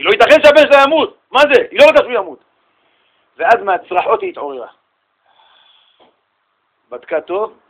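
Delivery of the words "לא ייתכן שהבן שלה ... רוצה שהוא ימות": loud, high, pleading outburst; a preacher voicing a frantic mother; mother's anguish. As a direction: plead loudly that he must not die. Mother's scream retold.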